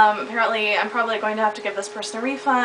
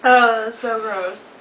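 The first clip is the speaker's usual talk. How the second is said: disgusted; 4 dB louder, slower than usual. Real, not acted. Tasting the blended meal.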